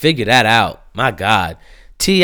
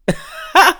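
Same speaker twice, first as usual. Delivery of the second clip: sudden high laugh, breaking up; laughing in disbelief at a twenty million net worth; high burst of laughter. Real, not acted. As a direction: cackle up high at the absurdity. Laughs at Lee Daniels' net worth.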